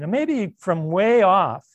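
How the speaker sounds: usual speaking voice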